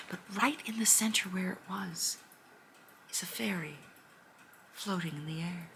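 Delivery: whispered reveal; soft storyteller whisper; hushed wonder. A narrator's reading. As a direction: hush the room for the magic.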